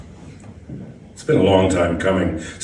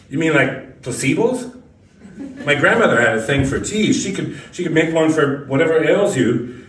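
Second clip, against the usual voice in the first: lightly voiced, higher casual young woman; a hint of a breezy young job applicant; 7 semitones higher than usual. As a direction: play the gum-chewing applicant a bit higher. Applicant voiced in interview story.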